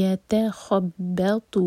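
یتخبط very slow, light با, heavy تا; drawn-out یتخبط, heavy تا; tajweed demo. Shown as a demonstration. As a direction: say یتخبط with تا full-mouthed. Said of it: "با کو light رکھیں گے".

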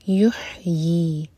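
یحیی stretched slowly with a throaty ha; throat ha. Shown as a demonstration. Teacher showing the throat ha in یحیی. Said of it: "ہا حلق سے".

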